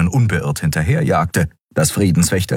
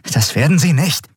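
through gritted teeth; 9 semitones higher than usual. A narrator's reading. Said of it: "knirschte Endriel".

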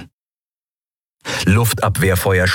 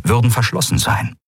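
ordinary delivery, nothing marked

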